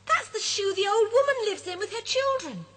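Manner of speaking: high, eager call pointing down; excited call. Acted. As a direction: interrupt with excitement. Rupert explains excitedly.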